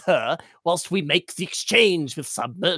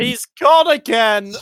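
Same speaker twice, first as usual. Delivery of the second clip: shouting; 5 semitones higher, 7 dB louder than usual. Acted. Characters calling out.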